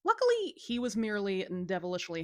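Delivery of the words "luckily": plain reading voice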